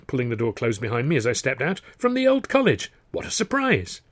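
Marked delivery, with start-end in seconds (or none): 2.0-4.0 high-pitched, innocent amazement; feigned surprise. Acted. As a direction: greet him as if delightfully astonished. Feigned greeting to stall the brother.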